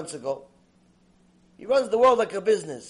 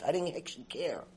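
ordinary talk, nothing marked

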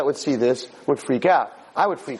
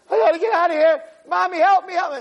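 high-pitched frantic plea; acted terror, voice way up; panicked child; 3 dB louder than usual. Boy running to mommy, panicked.

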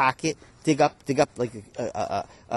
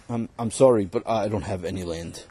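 lower, flat refusal from the boss; stingy employer; 6 semitones lower than usual. Acted. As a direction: brush him off from a low register. Employer refuses once more.

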